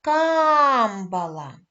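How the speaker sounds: "Камбала" loud and slow, "Кам" held long then falling; calling, first-syllable stress. Shown as a demonstration. Teacher giving the correct call, "Камбала" stretched on the first syllable. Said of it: "гукати на перший склад".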